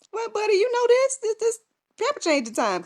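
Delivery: put-on high, sing-song coo to a toddler; baby talk. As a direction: talk to the baby like a cooing mom. Cooing to baby at diaper change.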